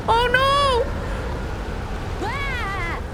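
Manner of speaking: high frightened yelp; panicked cry. Real, not acted. Streamer yelping as the ship gets swallowed.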